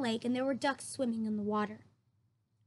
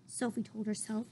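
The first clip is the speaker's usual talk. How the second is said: ordinary delivery, nothing marked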